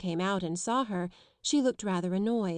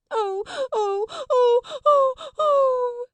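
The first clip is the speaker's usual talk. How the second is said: very high, loud wailing sobs; a tantrum of howls; howling; 9 dB louder, slower than usual. A narrator's reading. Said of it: "Lottie howled tempestuously".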